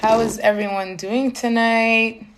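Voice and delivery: normal talking voice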